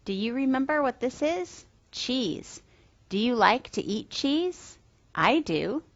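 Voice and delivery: gentle, singsong talk for toddlers; reading to a toddler. Acted. Teacher voice for toddler lessons.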